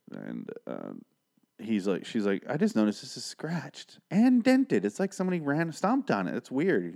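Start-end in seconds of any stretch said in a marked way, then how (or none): 2.5-6.9 complaining customer. Retold or imitated. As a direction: put on a puzzled woman's higher voice. Voices customer noticing damaged thimble.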